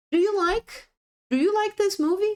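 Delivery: high rising "Do you like this movie?", quick; question intonation. Shown as a demonstration. Teacher showing a question with do, rising. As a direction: lift it up like a real question. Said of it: "I'm asking a question using do".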